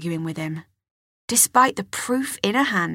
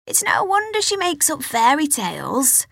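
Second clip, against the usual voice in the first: lightly voiced aunt, higher pitch; a hint of the dismissive aunt, raised pitch; mild higher aunt voice; Aunt Hetty; 7 semitones higher than usual. A narrator's reading. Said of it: "her Aunt Hetty would say".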